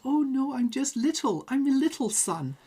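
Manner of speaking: high, timid little voice; shy little sun. Retold or imitated. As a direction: play it bashful and small. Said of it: "the sun is a little bit shy and says".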